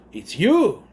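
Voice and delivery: playfully imitated surprise; mock recognition. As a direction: blurt it out in mock realisation. Acts her recognising him from cards.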